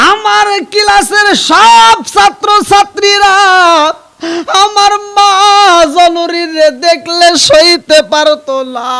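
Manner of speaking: high, loud, slow sermon chant; impassioned preaching. A narrator's reading. Preacher tells how classmates shunned his mother, impassioned preaching. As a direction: cry the story out in long, drawn notes.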